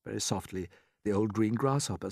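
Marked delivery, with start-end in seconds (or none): 0.0-0.7 soft. A narrator's reading. Hushed narration after the weeping.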